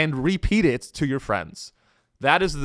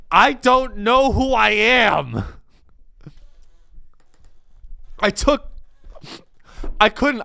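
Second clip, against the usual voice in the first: mock breakdown; 8 semitones higher than usual. Controller confessing to trainee, hammed-up despair. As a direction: fall apart in mock panic.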